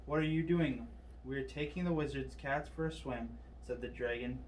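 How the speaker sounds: ordinary delivery, nothing marked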